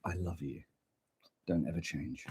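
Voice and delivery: low, soft tender murmur; soft mock declaration of love. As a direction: coo it gently.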